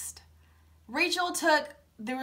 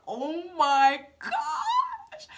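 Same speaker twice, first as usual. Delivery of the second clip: slow, stretched laughing gush; swooning laughing oh my gosh; 4 semitones higher, much slower than usual. Retold or imitated. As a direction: melt into a giggle. Melting over Brian.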